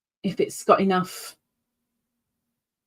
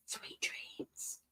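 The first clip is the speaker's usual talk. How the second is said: whispered goodnight; 23 dB quieter than usual. Real, not acted. Streamer to viewers going to sleep, soft whisper. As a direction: whisper sweet dreams softly.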